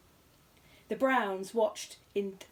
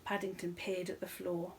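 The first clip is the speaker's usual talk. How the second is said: plain reading voice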